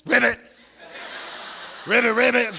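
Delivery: loud, high, drawn-out gang call. Acted. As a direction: croak a gang challenge like a frog. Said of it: "what they were saying on the other side".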